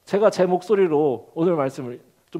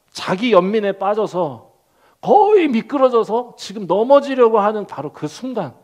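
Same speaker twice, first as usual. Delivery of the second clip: ordinary delivery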